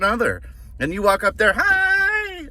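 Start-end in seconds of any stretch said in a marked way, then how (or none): 1.6-2.5 very high, drawn-out cheery "hi"; sing-song fake cheer; mock-happy greeting. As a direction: overdo a happy doorstep hello. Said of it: "all happy and shit".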